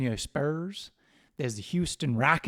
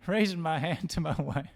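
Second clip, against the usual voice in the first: put-on Texan cowboy accent; 5 semitones higher than usual. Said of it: "I don't know what they do in Texas".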